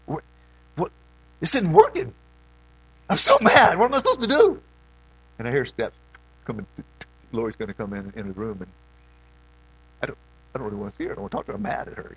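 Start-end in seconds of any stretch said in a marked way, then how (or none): 0.1-4.6 higher, exasperated cry of frustration; frustrated inner outburst. Retold or imitated. Pastor replaying his frustration during a fight with Lori, exasperated. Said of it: "And so I was like". 10.0-12.1 hushed admission. Retold or imitated. Hushed confession of staying angry.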